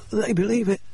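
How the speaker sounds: high, pained spluttering voice; sputtering injured man. Acted. Injured Murray sputtering to Holmes and Watson, high voice. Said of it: "Murray sputtered".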